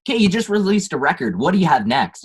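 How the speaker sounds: high, loud mock question from fans; hammed-up nagging fan asking what's next. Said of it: "they'll go".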